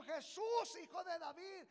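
loud, high call; direct cry. Acted. Blind man calling directly to Jesus, loud cry. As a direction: call straight to him. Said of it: "le dijo".

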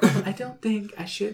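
high, soft mock British lady; imitating British lady. Host teases friend's British accent, high lady impression. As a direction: mimic your friend's peaked-up ladylike British. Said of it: "how you turned into a lady".

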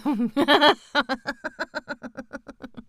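long, high-pitched giggle trailing off; real laugh. Real, not acted. Reader amused by the name Pop Over, long giggle. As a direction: break up laughing mid-reading.

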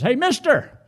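high parrot heckle; high-pitched bird hailing someone; parrot call. Acted. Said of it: "that parrot says".

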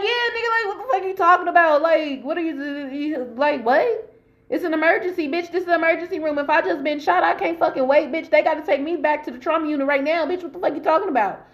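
high-pitched, outraged telling-off; acted exasperated tirade; indignant rant. Tells off the officer.

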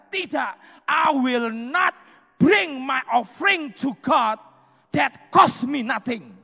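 slow, loud, emphatic vow; firm refusal. Acted. King David refusing Araunah's free offer, slow and emphatic. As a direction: refuse the gift with conviction.

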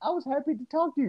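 high, fast, taken-aback protest; Michael Jordan. Acted. Imitating a star insulted by his old teammate, high confused protest. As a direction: squeal it in mock confusion, rushing. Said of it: "And Mike's like".

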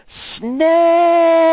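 very high, very loud terrified scream. Acted. Boy screaming to father about snake. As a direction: scream it in terror. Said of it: "All I could scream was".

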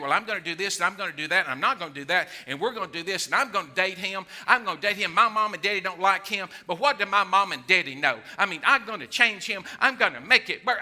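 hammed-up self-justifying chatter; defiant insistence. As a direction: rattle off a headstrong lover's excuses. Acting out a defiant dater.

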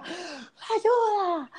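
high, far-off imitated scream; mock cry for help. Retelling the night screams, high mock yell. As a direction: squeal it like a distant victim.

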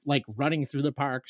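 ordinary talk

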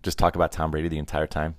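normal talking voice

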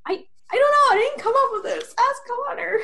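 high, loud, flustered deflection; flustered high protest. Retold or imitated. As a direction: squeak out a panicked excuse. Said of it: "I'm like".

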